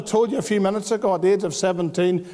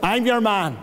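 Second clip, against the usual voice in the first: exultant retold shout; 5 semitones higher, slower than usual. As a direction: burst out with delight. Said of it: "And I shouted".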